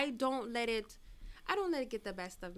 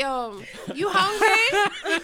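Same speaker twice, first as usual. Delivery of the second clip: mock caring woman; 7 semitones higher, 13 dB louder than usual. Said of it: "That's what women say".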